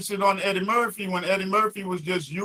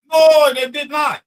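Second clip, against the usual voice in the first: high, loud, drawn-out contradiction; raised-voice argumentative rebuttal; angry denial; 7 semitones higher, 6 dB louder, slower than usual. Real, not acted. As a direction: deny it hotly, stretching the words. Heated quarrel, rebutting the other speaker.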